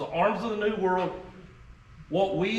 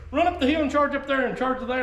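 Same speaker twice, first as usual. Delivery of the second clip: high, fast rallying order; imitated order to young men; 10 semitones higher, 4 dB louder, faster than usual. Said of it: "like you can tell young men".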